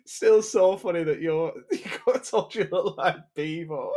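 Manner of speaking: giggling through the words; laughing while talking. Real, not acted. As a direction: laugh through the whole line. Laughing at a friend's insult.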